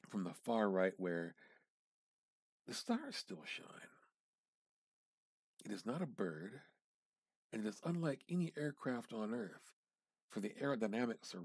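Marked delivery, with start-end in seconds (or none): none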